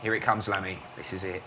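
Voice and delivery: low, hushed, tense whisper-like commentary; hushed build-up. Pretend. Commentator building suspense before the delivery, low and hushed. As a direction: hold your breath before the ball comes.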